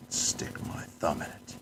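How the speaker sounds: soft, breathy muttered repeat; whispered aside. Real, not acted. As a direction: mutter it to yourself. Quiet repeat of the line.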